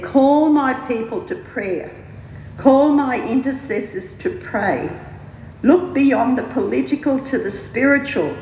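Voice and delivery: lightly voiced divine summons, pitched high; prophetic call. A narrator's reading. Voicing the Lord's call to pray.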